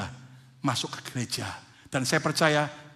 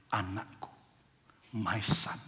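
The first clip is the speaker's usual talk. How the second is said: much softer, lower and gentle; tender father; 8 semitones lower, 7 dB quieter, slower than usual. Acted. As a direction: speak softly as a loving father. Father speaking tenderly to son.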